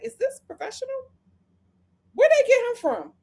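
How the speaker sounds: incredulous squeak. Retold or imitated. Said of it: "And I was just like".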